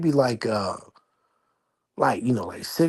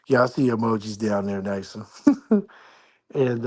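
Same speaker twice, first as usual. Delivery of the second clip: ordinary talk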